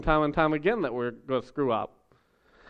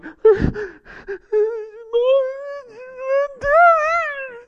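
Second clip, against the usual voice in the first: put-on high crying wail of a small kid; child's wail; 4 dB louder than usual. Lost boy wailing at the store front. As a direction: let out a lost child's sob. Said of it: "like, crying".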